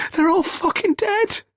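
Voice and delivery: very high, frantic shout. A narrator's reading. Joseph waves the gun around, frantic shouting. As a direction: yell it out in horrified anguish.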